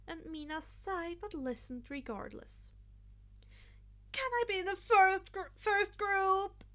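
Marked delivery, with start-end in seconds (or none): 4.1-6.6 put-on high, loud begging girl voice; pleading. Mina begging Izuku for first group, high and pleading. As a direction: wheedle with puppy eyes. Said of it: "turning puppy eyes".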